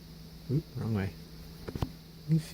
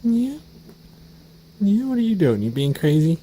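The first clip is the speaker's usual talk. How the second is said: higher, louder sing-song coaxing; coaxing pet talk; 9 semitones higher, 7 dB louder than usual. Real, not acted. Owner coaxes the kitten to come over, high sing-song pet talk. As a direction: call the kitten over, bright and eager. Teasing the playful cat.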